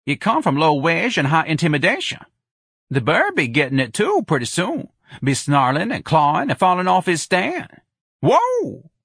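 high, animated heckling, ending on a whoop; jeering Jones character. A narrator's reading. Jones warning about the bird, jeering. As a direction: heckle with rising relish.

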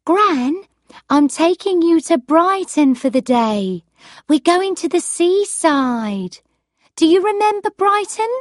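cheerful granddaughter voice; young woman voice. Acted. Cindy voiced by reader.

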